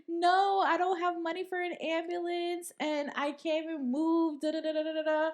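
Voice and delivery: high, whiny put-on excuse; fake crying performance. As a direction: whine like a roommate faking an injury. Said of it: "I'm looking at a performance".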